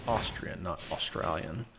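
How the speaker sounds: Austrian said softly and distinctly, set against Australian; correcting the mix-up, clear stress on Austrian. Shown as a demonstration. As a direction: spell out Austrian, not Australian. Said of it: "Austrian company".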